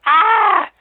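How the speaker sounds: very high, very loud imitated scream; hag's shriek. Nightmare hag confronted by the child, loud acted shriek.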